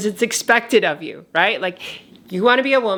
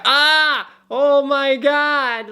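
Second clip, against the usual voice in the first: higher, louder sudden yelp; 7 semitones higher, 3 dB louder, much slower than usual. Retold or imitated. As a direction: blurt it out in shock. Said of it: "let out, like, a yelp".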